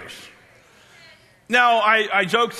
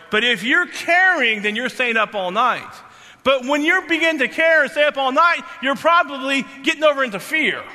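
raised, urgent preacher delivery; fervent preaching; 5 semitones higher, 3 dB louder than usual. Acted. Pastor exhorting the congregation, raised preaching voice. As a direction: press the point to the congregation.

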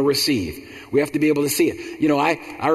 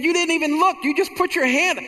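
high, disbelieving mother; mama's astonished voice. Retold or imitated. Mother marvels that her son just reached blindly, high astonished voice. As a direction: marvel at him, pitched up very high. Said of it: "She said".

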